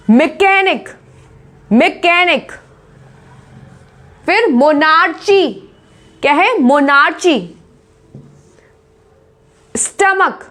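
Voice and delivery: normal talking voice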